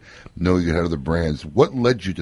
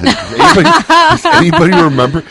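laughing; 11 dB louder than usual. Real, not acted. Laughing loudly on air.

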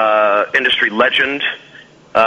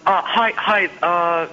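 awkward greeting; 7 semitones higher than usual. Acted. Caller in put-on character voice.

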